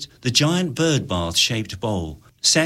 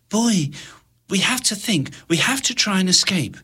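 put-on higher pleading girl's voice; urgent girl; 10 semitones higher than usual. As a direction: press him to act. Milena urges Boy.

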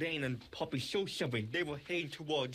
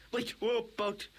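ordinary delivery, nothing marked

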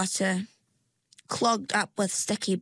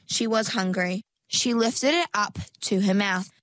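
ordinary delivery, nothing marked

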